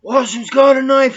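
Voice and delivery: a cop shouting that the prisoner is armed; alarmed shout. Retold or imitated. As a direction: yell to warn the others about the weapon. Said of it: "he's like".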